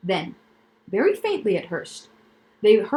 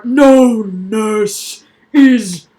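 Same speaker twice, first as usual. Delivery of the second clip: booming laugh-line; 10 dB louder, much slower than usual. Acted. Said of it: "doubling up with laughter".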